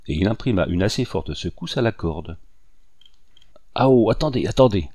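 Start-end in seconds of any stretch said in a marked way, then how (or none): 3.7-4.9 a loud frightened cry to stop; panicked plea. Acted. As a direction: beg him to wait, short of breath. Strangled man begs to wait. (supplication paniquée et forte)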